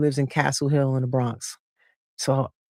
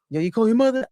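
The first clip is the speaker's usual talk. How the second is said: friend asking; 7 semitones higher, faster than usual. Retold or imitated. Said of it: "I'm in the Bronx".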